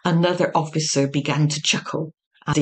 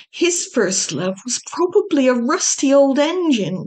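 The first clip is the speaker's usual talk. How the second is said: sniffling; 7 semitones higher than usual. Acted. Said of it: "sniffed Matthew".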